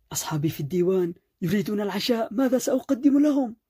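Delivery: fast, high, climbing angry outburst; furious yelling. A narrator's reading. Husband with waiting guests rails at his wife, furious yelling. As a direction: build to a panicked, high-pitched yell. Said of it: "واصل صراخه العنيف في وجهها".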